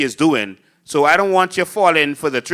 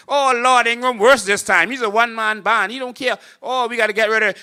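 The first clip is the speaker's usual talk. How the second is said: higher, exasperated mock lament; hammed-up despair, pitched up; mock fed-up voters; 8 semitones higher, faster than usual. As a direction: play the crowd groaning at its leader. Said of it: "Swing hard back to Ingram".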